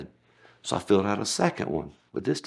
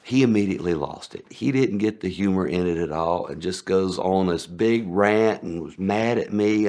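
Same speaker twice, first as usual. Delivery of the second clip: usual speaking voice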